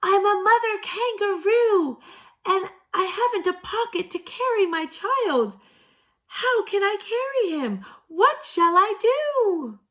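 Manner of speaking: very high, loud pleading scream. A narrator's reading. Katy screaming up at the deaf owl. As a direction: yell up into the tree. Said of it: "screamed up at him".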